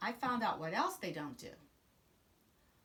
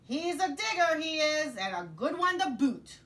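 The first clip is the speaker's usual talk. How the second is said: voz aguda e alta de capitão pirata; pirata gritando para a tripulação; 8 semitones higher, 9 dB louder than usual. Acted. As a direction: grite o elogio para o convés. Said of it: "and then yelled back to his crew".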